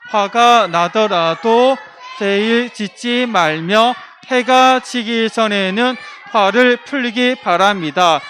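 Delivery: slow, shouted scripture reading in unison; loud group recitation. Real, not acted. Children reading the red verse aloud with the teacher, slow loud unison. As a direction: read the verse out together slowly at full voice. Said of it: "손 들고 우리 빨간 글씨 한번 읽어봅시다".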